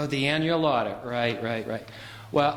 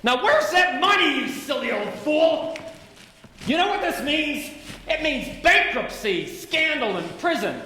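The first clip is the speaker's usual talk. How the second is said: furious shouting; 6 semitones higher than usual. Acted. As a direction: tear into him in a rage. George berates Uncle Billy.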